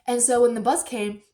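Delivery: usual speaking voice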